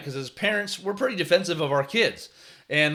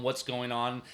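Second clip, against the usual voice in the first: casual, softer check-in question; 2 semitones lower, 5 dB quieter, slower than usual. Retold or imitated. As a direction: ask gently, without any scolding. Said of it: "checking in with players".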